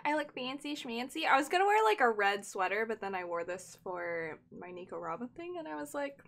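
normal talking voice

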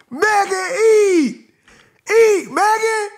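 loud, high cheering; hype cheer. Real, not acted. Vlogger cheering [name]'s verse, loud hype. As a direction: cheer her like she's winning.

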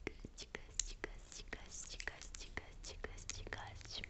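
tiny, barely audible whispered repetitions; hushed ASMR word loop; whispered echo. Acted. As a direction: repeat "goste" as a faint, rhythmic whisper. Repeats last word as ASMR echo.

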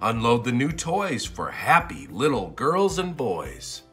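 ordinary delivery

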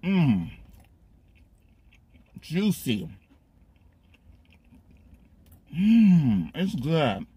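speaking between chews; chewing while talking. Real, not acted. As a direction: mouth full of food. Seen 2: Bites at -9, then chews with lips closed throughout the labelled words.

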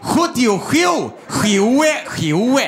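slow, measured lottery number call; Keno draw announcer. Acted. Host reads the Keno draw, slow announcer delivery. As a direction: read out each number slowly like a lottery host. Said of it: "nu den rätta Keno-dragningen".

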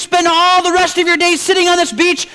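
high, loud exasperated shout; shouting businessman. Acted. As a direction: yell it at him in frustration. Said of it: "And he started to shout".

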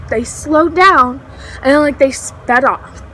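usual speaking voice

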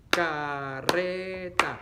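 very slow, drawn-out "Carreta" in three syllables; sílaba por sílaba. Shown as a demonstration. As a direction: split "Carreta" as ca, rre, ta. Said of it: "¿Cuántas sílabas tiene la palabra carreta?". ("Carreta" muy lenta, separada en sílabas)

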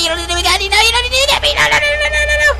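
high panicked stream of no, rushing mid-way; screaming no as the neighbor closes in; frantic pleading. Real, not acted. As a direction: beg frantically to be left alone. Neighbor chasing him again.

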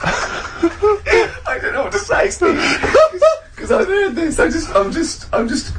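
high giggling through the words; words squeezed out through helpless laughter; laughing. Real, not acted. Host laughing helplessly at Karl's baby-in-the-bathwater story.

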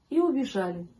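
usual speaking voice

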